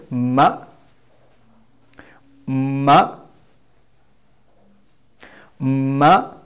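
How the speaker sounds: "ma" said alone, rising at the end; model pronunciation. Shown as a demonstration. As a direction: model "ma" for the class to repeat. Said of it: "say again".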